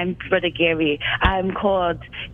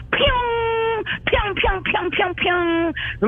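high, drawn-out pew, then quicker descending pews; imitated firing-up sound; 12 semitones higher, much slower than usual. Caller plays a phoenix firing up, high imitated zaps. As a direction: voice a phoenix bursting into flame.

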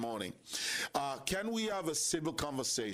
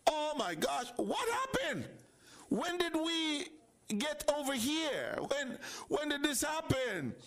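much higher, bewildered outcry; stunned disbelief; 11 semitones higher than usual. Pretend. Host acting out a friend stunned by rude words, high bewildered outcry. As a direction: throw up your hands in shock. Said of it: "You're saying".

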